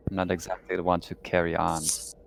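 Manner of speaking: ordinary talk, nothing marked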